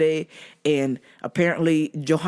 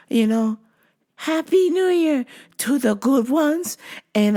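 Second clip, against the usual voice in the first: slow, higher, sugary delivery; creepy stalker; 4 semitones higher than usual. Retold or imitated. Stalker blessing the good ones, creepy slow sweetness. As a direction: drawl the greeting in a put-on voice. Voices stalker's stock greeting.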